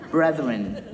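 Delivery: ordinary talk, nothing marked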